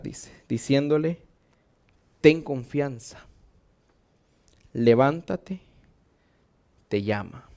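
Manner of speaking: low, hushed sermon delivery; predicación solemne en voz baja. Real, not acted. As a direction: drop low and let the lesson sink in. (prédica grave y pausada en voz baja)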